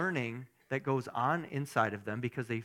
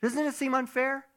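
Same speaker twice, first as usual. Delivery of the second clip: higher, louder upset Martha voice, slowed at the start; 11 semitones higher, 5 dB louder than usual. Acted. Preacher voicing Martha complaining to Jesus, higher and louder. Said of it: "She's mad".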